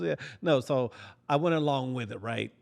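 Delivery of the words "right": ordinary delivery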